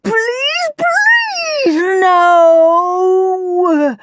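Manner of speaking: very high, wailing plea; shrieked pleading. A narrator's reading. Henry begs Nurse Needle, shrieking. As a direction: beg desperately, last word stretched and loud. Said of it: "shrieked Henry".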